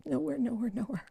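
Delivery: nowhere, nowhere, nowhere dying to a murmur; fading repetition. Real, not acted. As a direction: let nowhere fade out. Repeated fading emphasis.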